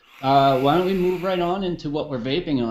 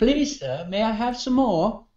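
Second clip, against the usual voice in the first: higher-pitched, pleading British urchin; mock British accent; 6 semitones higher than usual. Co-host showing off a British accent for friends, mock British pleading. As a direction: beg politely in a put-on British accent. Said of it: "done the show with a British accent".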